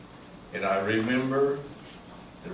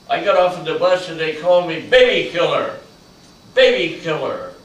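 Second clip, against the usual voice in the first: louder and fast, bitter; indignant outcry; 4 semitones higher, 10 dB louder, faster than usual. Retold or imitated. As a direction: spit the insult back out. Veteran's letter, anger at insult.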